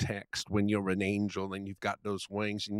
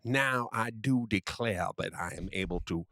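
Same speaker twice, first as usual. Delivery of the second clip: put-on Southern gentleman accent. Friend keeps up the Southern gentleman bit, mild Southern accent. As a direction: grandly declaim as a genteel Southern gentleman. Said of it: "I must use this voice".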